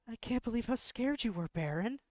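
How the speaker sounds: put-on high, light teasing girl. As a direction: tease him playfully. Character teases the player.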